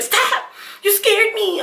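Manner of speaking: high, startled mom, laughing; panicked mom. Retold or imitated. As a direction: squeal it like a frightened mother. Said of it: "she will lose it".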